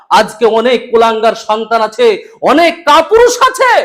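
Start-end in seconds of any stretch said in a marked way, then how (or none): 2.4-3.8 high, slow and scornful; scornful preaching. Acted. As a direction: pour scorn on the cowards. Condemning the bad sons.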